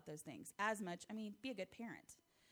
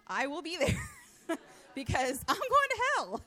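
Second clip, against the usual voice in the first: laughing; 9 semitones higher, 13 dB louder than usual. Real, not acted. Laughing at her childhood fear.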